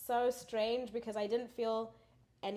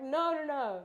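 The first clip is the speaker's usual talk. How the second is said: higher, louder playful denial; mock protest; 7 semitones higher, 6 dB louder, slower than usual. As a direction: protest in a put-on voice, half laughing. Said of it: "I'm like".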